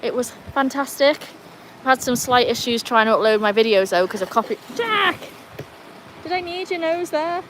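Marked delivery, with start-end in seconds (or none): 4.8-7.4 high-pitched sudden cry to a dog; real exasperated high call; calling out sharply. Real, not acted. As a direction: cut in abruptly to scold the dog. Scolding dog, cuts off own sentence.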